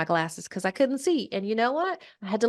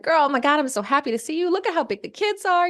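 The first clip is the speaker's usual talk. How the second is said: high-pitched, gushing reunion greeting; retold squeal of delight, pitched up; excited greeting; 7 semitones higher, faster than usual. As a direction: greet a long-missed friend with acted excitement. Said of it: "really excited".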